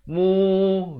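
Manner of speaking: "Mu" chanted high with a held vowel; two-beat long U. Shown as a demonstration. Teacher counting vowel length, "Mu" drawn out. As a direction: hold "Mu" for two beats. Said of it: "dua harakat".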